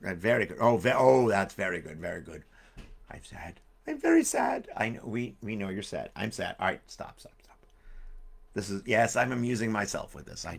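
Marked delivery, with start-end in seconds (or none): 3.1-4.7 mock sad puppet. 6.9-7.6 hushed whispered stop. Real, not acted.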